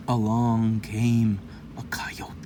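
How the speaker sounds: slow, whispered ominous entrance; slow hushed whisper; spooky whisper. A narrator's reading. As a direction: breathe it like a secret danger.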